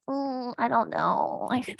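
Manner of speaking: soft, slow, hesitant mumble; a doubtful student mumbling, barely audible; unsure mumble. Retold or imitated. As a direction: sound like you don't believe in it. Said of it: "That is a terrible attitude".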